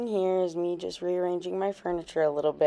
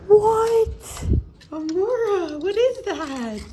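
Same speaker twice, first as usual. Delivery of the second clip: high squealing surprise; excited surprise; 4 dB louder, much slower than usual. Real, not acted. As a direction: squeal it out, amazed. Opening surprise package.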